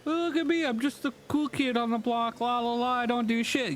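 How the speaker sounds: put-on high, sing-song cool-kid voice; mock show-off. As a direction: prance through it like a smug cool kid. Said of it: "you're not like the spectacular".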